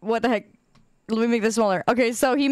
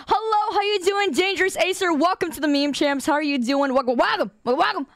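high, sing-song welcome; playful host welcome; over-eager greeting; 5 semitones higher than usual. Pretend. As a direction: greet arrivals in a raised, bubbly pitch. Mock greeting of arriving viewers.

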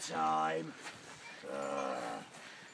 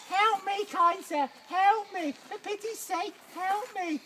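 high, fluttering cries for help; damsel voice; 13 semitones higher than usual. Acted. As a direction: play the damsel in distress, pitched high. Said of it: "Put on my damsel voice".

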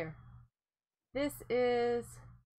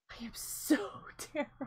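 whispered self-blame with a sigh; 4 dB quieter than usual. Real, not acted. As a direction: breathe it out, embarrassed.

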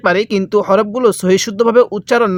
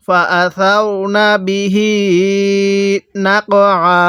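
measured chant with the last "hee" of বিহি held; slow Quran recitation; slower than usual. Shown as a demonstration. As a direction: recite slowly, stretching the end of বিহি. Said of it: "আরেকবার পড়ুন আমার সাথে".

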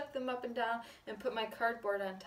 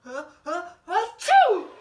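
short gasps into a high, loud achoo; building sneeze; 8 semitones higher, 16 dB louder than usual. Acted. Reader ends the story with a big mock sneeze. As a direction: gasp twice, then explode in an acted sneeze. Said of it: "I'm gonna sneeze".

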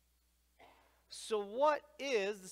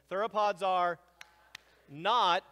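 loud call-along; 2 semitones higher, 8 dB louder, slower than usual. Shown as a demonstration. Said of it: "everybody do that with me".

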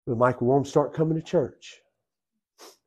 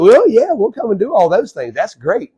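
1 mock eager agreement; 9 semitones higher, 8 dB louder than usual. Acted agreeable reply.

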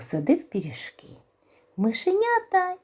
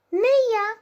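lazy mouse; 5 dB louder than usual. Acted. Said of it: "каже й друге мишеня".

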